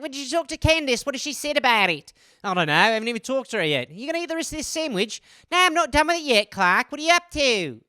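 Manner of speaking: put-on Australian accent, soapy drama; mock Australian accent. Aussie soap characters bickering over sandwich, Australian accent.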